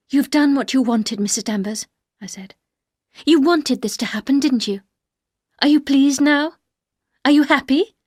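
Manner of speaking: confronting the housekeeper, loud and accusing; accusing outburst. Acted. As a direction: throw the accusation at her. Heroine confronts Mrs Danvers.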